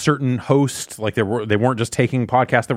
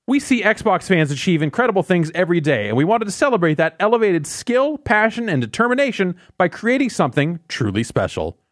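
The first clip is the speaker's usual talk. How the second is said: ad read; 9 semitones higher than usual. Acted. Reads the quoted press statement.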